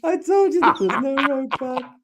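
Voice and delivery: squeaky-high words through laughter; helpless laughter. Real, not acted. Man cracking up after his accent attempt, helpless laughter. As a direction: laugh so hard the pitch shoots way up.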